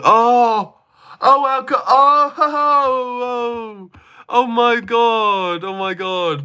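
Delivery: high shrieking oh-my-god, hammed-up and slow; mock shock. Streamer mocks the troll's alcoholic joke, hammed-up high shock. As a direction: squeal it like you're scandalised, for a laugh.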